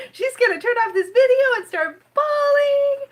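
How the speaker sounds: high, slow teasing quote; quoting Betty. Retold or imitated. Betty teasing that she will cry after filming, slow and high. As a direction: mimic a friend's teasing. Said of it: "She's like".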